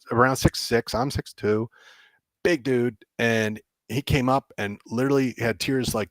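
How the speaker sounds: ordinary talk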